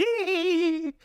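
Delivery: squeaky imitated girly laugh; mock giggle; high mock giggle. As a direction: mimic her giggle up high.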